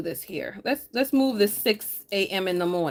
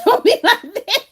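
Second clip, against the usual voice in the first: laughing tease; 12 semitones higher, 8 dB louder than usual. Real, not acted. Laughing, teasing a viewer.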